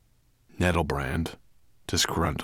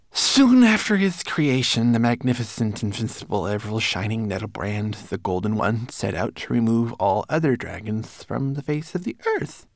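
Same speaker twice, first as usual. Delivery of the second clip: recited; 5 semitones higher, 4 dB louder than usual. A narrator's reading. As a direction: recite grandly in a small servant voice. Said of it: "Twigleg obediently recited".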